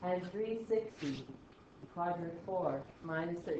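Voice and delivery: soft, slow and level; slow, uninflected talk. Shown as a demonstration. Teacher reading out answers to class, slow and uninflected. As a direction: hold back all inflection, taking your time. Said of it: "it actually hurts to talk like this".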